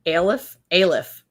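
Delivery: ordinary talk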